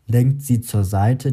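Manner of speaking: ordinary delivery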